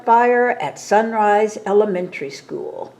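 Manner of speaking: ordinary delivery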